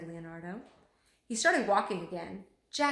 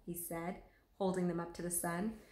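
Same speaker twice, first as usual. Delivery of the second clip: usual speaking voice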